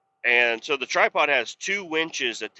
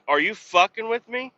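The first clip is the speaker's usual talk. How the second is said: incredulous; 10 semitones higher than usual. Retold or imitated. Retold disbelief at shave order.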